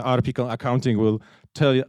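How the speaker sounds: ordinary talk, nothing marked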